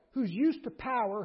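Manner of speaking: ordinary talk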